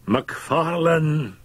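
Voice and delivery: high, slow, drawn-out hail; loud herald's call. A narrator's reading. Fettes hailing the doctor on the stairs, heraldic call. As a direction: announce the name like a herald. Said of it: "he said somewhat loudly, more like a herald".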